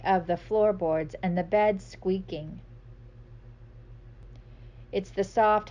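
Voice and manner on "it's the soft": usual speaking voice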